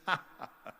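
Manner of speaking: imitated scornful agent's laugh; mock laugh. Agent laughs off bodybuilder's movie ambition, mock laughing. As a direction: fake a burst of dismissive laughter. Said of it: "He started laughing".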